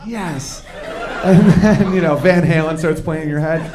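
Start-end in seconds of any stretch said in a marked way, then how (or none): 0.1-0.6 much softer, hammed-up private victory; quiet triumphant yes. Boyfriend praised by girlfriend, quiet triumphant yes. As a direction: savour the win under your breath. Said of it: "You're like".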